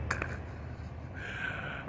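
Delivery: short unvoiced laugh; breathy laugh. Real, not acted. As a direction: let out a quiet breathy laugh. Laughing at retold scene.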